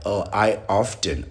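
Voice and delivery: mimicking "often" said as OFF-ten; T pronounced in often. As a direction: put a T in "often", mockingly quoting. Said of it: "When everybody's like".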